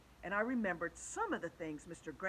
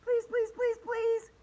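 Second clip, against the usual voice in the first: high, loud, insistent pleading; begging; 8 dB louder, slower than usual. A narrator's reading. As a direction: plead like a crowd of kids. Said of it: "we all begged".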